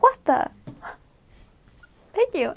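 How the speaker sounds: high-pitched startled gasp, then thanks; surprised thanks. Retold or imitated. Startled thanks to a Chilean's compliment, high and surprised. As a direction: caught off guard by the compliment, squeak it out. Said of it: "It's just like".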